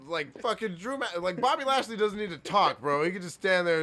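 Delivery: usual speaking voice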